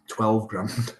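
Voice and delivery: ordinary delivery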